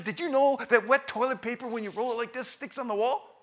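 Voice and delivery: put-on high, eager kid voice; excited kid. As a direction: pitch it up like a boy showing off a trick. Quoting the boy's prank.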